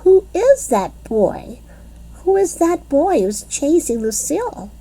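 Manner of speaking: lightly voiced worried little girl; a hint of an alarmed girl. A narrator's reading. Grace asking Junie B who the boy is, lightly worried. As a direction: ask it like a nervous kid.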